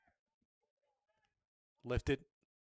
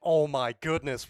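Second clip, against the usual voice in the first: excited; 8 dB louder than usual. Real, not acted. Replay reaction after goal.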